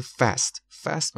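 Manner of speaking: fast with the American 'a' of cat; American pronunciation. Shown as a demonstration. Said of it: "کلمهٔ امریکنه تلفظش". (تلفظ امریکن fast با «اَ»)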